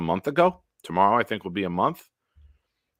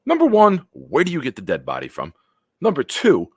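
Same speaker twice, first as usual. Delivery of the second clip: loud ranting list; 3 semitones higher, 7 dB louder than usual. Real, not acted. Counting off outraged points.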